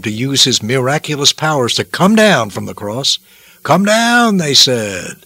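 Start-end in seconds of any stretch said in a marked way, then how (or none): 3.6-4.4 high, drawn-out jeer; taunting call. Acted. Roman soldiers challenge Jesus on the cross, taunting. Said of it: "Roman soldiers taunted him".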